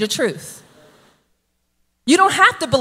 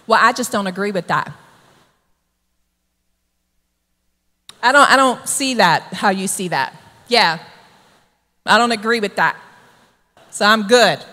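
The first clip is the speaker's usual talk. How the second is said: low, dismissive disagreement; mildly indignant; 6 semitones lower than usual. Acted. Sinner excusing sin, refusing to agree, indignant and dismissive. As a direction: brush it off in a lower voice. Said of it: "You're indignant".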